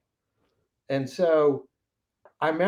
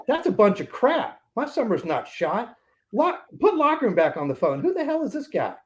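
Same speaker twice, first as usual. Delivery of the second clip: higher-pitched, outraged protest at the bad news; indignant retort; 8 semitones higher than usual. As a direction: push back hard, offended, pitch climbing to the last question. Retorts to the sales manager.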